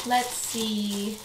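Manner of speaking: ordinary talk, nothing marked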